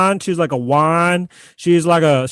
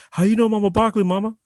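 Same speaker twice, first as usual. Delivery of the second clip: put-on young Shaq, higher; 5 semitones higher, faster than usual. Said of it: "He's like".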